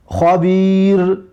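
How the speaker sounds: خبیر drawn out slowly with a throat kh; careful kh. Shown as a demonstration. Teacher reading the drill word for pupils, slow kh. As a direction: stretch خبیر, rasp the خ. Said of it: "نوشته شده است که خبیرون".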